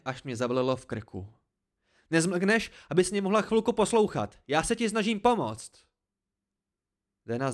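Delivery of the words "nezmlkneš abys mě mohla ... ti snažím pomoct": high, loud, angry demand; exasperated outburst. A narrator's reading. Narrator insists he is helping Dena, exasperated. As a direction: demand to be heard. Quarrel continues at shouting pitch.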